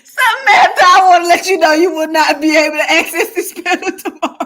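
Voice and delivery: high, loud scolding through laughter; laughing to tears. Real, not acted. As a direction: shout the ban while helplessly laughing. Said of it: "like I'm crying real tears".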